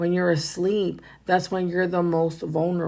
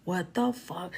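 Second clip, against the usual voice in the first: whisper; 12 dB quieter, slower than usual. Retold or imitated. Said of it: "I was like".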